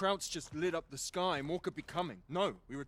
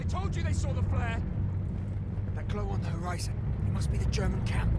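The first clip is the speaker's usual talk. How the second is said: louder urgent report; urgent loud call; 7 dB louder than usual. Acted. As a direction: call it out to the squad. Spotting the flare and camp.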